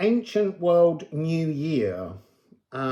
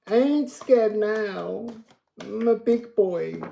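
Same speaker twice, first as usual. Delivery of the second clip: mock big boy; 8 semitones higher than usual. Mock brave child voice.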